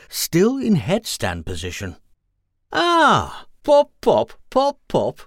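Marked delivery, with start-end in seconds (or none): 2.7-5.2 high, loud copying voice; odd passerby copying. Acted. Strange passerby copying the witch's fish pops, high. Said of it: "said the strange-looking person".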